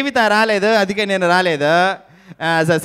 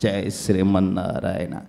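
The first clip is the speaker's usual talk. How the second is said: spiritual elder impression; 6 semitones lower than usual. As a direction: bless the crowd like a revered guru, low and hushed. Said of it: "play for mimicry". (గురువు గారి లాగా లోతుగా, మెల్లగా ఆశీర్వాదం)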